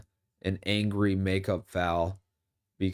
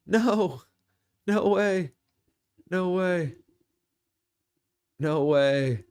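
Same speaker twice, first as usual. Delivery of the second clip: high, stunned cries fading down; disbelief; 10 semitones higher, slower than usual. Real, not acted. Streamer reacting live to a pick, shocked cries. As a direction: cry out no way, coming down bit by bit.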